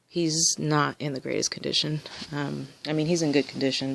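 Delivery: ordinary talk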